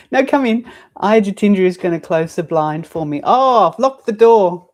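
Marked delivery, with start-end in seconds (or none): 0.1-0.7 no, come in, raised over the room; calling out. Real, not acted. 3.2-4.7 louder, slower, caught out; oh, discovering the locked door aloud; teacher finds the door locked, loud surprise; surprised realisation. Real, not acted.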